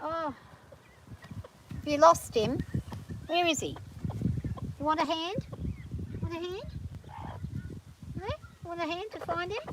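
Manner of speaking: high, soft coo to a hen; soft pet talk. Real, not acted. Softly talking to chickens.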